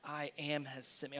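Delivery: low, hushed thinking to himself; puzzled inner thought. Acted. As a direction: murmur it under your breath, doubtful. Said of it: "thinking in his eyes like".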